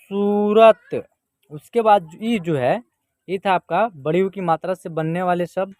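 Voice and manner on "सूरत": सूरत loud, with a long first vowel; drawn-out model reading. Shown as a demonstration. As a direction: stretch the ू in सूरत. Teacher models the blended word.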